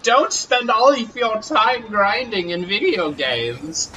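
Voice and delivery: put-on high, slow old crone. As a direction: croak it out like a witch. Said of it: "the crone voice".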